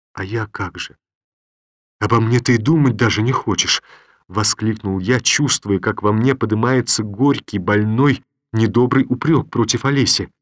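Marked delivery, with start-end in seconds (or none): none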